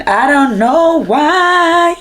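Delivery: just-woke-up croak, drawn out; morning voice. Real, not acted. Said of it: "That's my morning voice".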